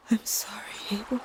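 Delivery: a breathy tearful whisper. A narrator's reading. Narrator whispering farewell to Abel as he sinks into the water. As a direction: whisper goodbye to a dead friend. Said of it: "we whispered".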